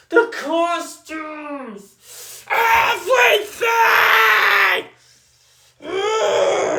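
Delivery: high shouted outburst ending in a groan; exasperated shout. Real, not acted. Fed-up reviewer exploding at the costumes, shouted high. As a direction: blow up on the last word. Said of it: "did not hear me screaming out loud or yelling".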